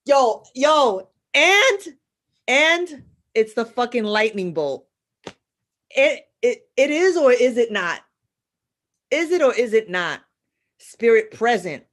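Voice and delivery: loud, high, excited bursts; fired up. Real, not acted. Calling on the spirit to the audience, loud and excited. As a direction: caught up in the spirit. Fired up about Chango's lightning.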